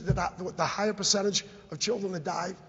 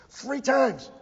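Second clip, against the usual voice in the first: outraged repeat; 4 semitones higher than usual. Real, not acted.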